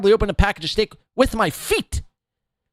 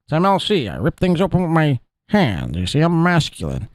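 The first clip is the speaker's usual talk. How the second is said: imitating Pat Dixon. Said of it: "he's doing all his mannerisms".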